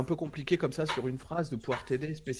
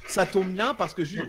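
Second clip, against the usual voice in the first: ordinary talk, nothing marked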